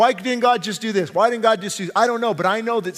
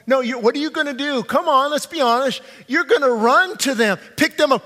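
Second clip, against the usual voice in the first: raised, urgent preaching; impassioned preaching; 5 semitones higher, 4 dB louder than usual. Acted. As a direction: drive the point home to the parents. Preaches at full force.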